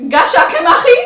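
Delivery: a loud teasing correction. Retold or imitated. Taxi man correcting her, loud and teasing.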